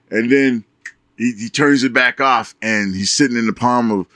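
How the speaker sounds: normal talking voice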